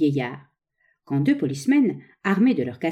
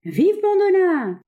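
long drawn-out high cheer; cheering cry; 13 semitones higher, 4 dB louder, much slower than usual. A narrator's reading. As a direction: cheer from the crowd, stretching the name. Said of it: "cria une voix".

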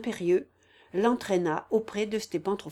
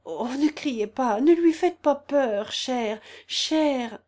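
slow, higher, distressed plea; anxious pleading; 5 semitones higher, 5 dB louder, slower than usual. A narrator's reading. Sick man begs his dear not to shout, anxious pleading. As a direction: beg slowly in a raised, troubled voice.

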